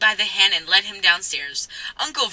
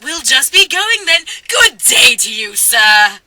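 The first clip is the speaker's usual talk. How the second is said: cheery farewell; 4 semitones higher, 6 dB louder than usual. Acted.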